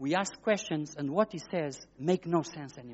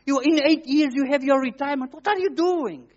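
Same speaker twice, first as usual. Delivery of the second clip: mocking colleagues; 10 semitones higher, 9 dB louder than usual. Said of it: "they were telling all names".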